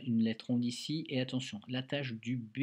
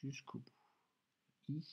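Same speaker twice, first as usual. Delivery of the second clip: drawn out; 9 dB quieter, much slower than usual. Shown as a demonstration. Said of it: "et je continue".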